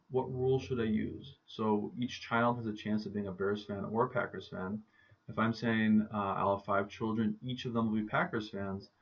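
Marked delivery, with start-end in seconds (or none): none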